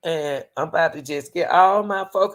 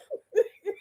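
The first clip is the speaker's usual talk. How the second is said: a quick high squeal of a laugh; high giggle. Real, not acted. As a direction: let a giggle slip out. Laughing at men's talk.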